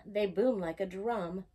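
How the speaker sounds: ordinary delivery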